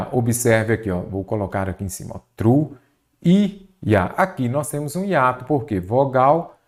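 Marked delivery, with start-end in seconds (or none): 2.3-4.0 "Truía" broken as tru... í... a, with gaps; split into syllables. Shown as a demonstration. Teacher showing where the hiatus falls, "Truía" in separate syllables. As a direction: pull the vowels of "Truía" apart. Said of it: "separei em sílaba".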